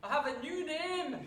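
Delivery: self-mocking Scottish accent, breaking into a laugh; hammed-up Scottish brogue with a laugh. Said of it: "I'm not very good at the Scottish accent".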